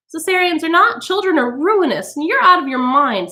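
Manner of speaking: high, huffy retort; indignant society woman. A narrator's reading. Mrs Phelps answering Montag about children, huffy. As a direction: snap back offended.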